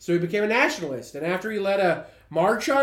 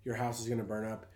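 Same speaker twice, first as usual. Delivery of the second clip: imagined threat, low and very quiet; deadpan threat; 10 semitones lower, 11 dB quieter than usual. Pretend. Imagined Girl Scout to homeowner, deadpan threat. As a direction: drop low and soft for a mock threat.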